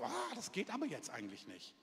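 high, soft reluctant wince; reluctant excuse. Acted. Preacher acting out an inconvenient request, high reluctant voice. Acts out reluctance about a full schedule.

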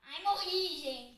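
sudden loud cry of defeat; loud outcry. Real, not acted. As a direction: cry out at dying. Game death outcry.

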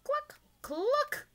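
very high mock clucks; high hen cluck; chicken clucking. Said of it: "says Chicken".

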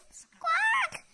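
high shouted bird squawk; loud parrot squawk. Acted. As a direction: screech it like a parrot.

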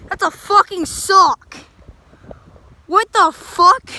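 loud, slow disbelief; shocked shout at a sock; surprised. Real, not acted. Finds sock in road.

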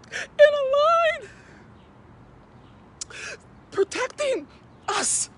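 very high, loud, choked-up cry, slowly forced out; overcome, near tears. Real, not acted. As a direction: push the words out through welling tears. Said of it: "before I start crying".

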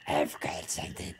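extremely low, croaked demon voice; demon bear. Pretend. Bear offering a secret, very low demonic croak. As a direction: drop to the bottom of your range, quiet croak. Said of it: "his voice sounds like demons".

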